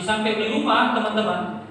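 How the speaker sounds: normal talking voice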